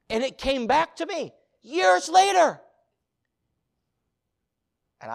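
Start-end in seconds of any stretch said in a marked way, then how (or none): 0.1-2.6 loud, high, slow outcry; impassioned preaching. Real, not acted. Preacher recalling the voice years later, impassioned outcry. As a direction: cry it out to the whole room. Preacher stresses the memory returning.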